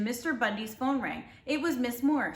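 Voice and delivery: plain reading voice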